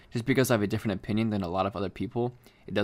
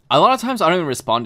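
exasperated; 6 semitones higher, 9 dB louder, faster than usual. Real, not acted. Ranting about hate DMs.

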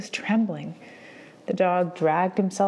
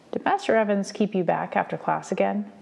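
plain reading voice

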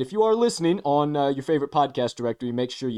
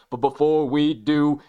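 usual speaking voice

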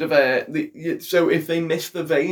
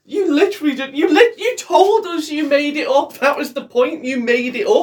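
high, louder indignant ranting; indignant accusation; 9 semitones higher, 4 dB louder than usual. Real, not acted. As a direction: call out his made-up story, fired up. Heated mock accusation.

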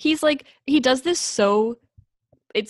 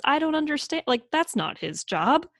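usual speaking voice

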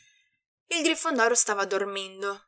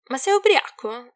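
loud, higher-pitched incredulous question; indignant, raised voice; hissed; 5 semitones higher, 8 dB louder than usual. A narrator's reading. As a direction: demand it with sharp irritation. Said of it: "sibilò Harry".

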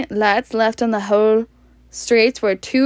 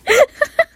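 high, helpless laughing fit; helpless laughter; 4 dB louder than usual. Real, not acted. Reader cracks up at a silly name, helpless laughter. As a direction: crack up uncontrollably, high-pitched.

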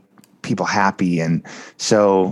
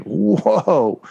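stunned whoa; 5 semitones higher than usual. Retold or imitated. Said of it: "And I'm like".

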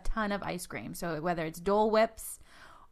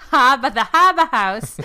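loud Boston "Hah-buh House"; "Harbor" with no r, Boston style; Boston accent; 5 semitones higher, 13 dB louder than usual. Shown as a demonstration. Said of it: "Boston accent comes out really".

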